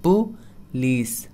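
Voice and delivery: Police stretched, pu-LEES; very slow demo. Shown as a demonstration. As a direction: drag out police for the learner. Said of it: "correct pronunciation होगा police".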